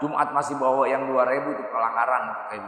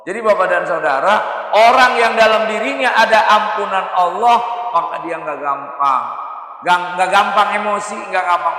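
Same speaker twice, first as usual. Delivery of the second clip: raised, emphatic sermon voice; forceful preaching; 6 semitones higher, 6 dB louder than usual. Real, not acted. Preacher explaining who is granted paradise, emphatic preaching. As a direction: press the point on the listeners.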